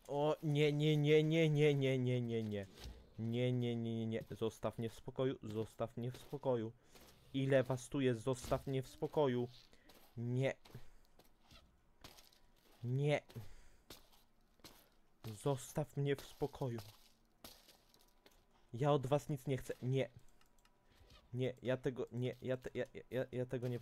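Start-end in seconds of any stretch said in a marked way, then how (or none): 0.1-4.2 loud, high frantic string of nie; panicked yelling. Real, not acted. Swarmed by mobs in game. 7.3-22.8 raised, frustrated pleas; exasperated yelling. Real, not acted. Fending off monsters.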